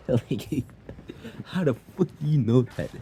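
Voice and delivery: high, quick words squeezed through laughter; laughing mock disbelief. Mom baffled that kid knows the name, retold laughing. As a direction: giggle through a mock-incredulous question.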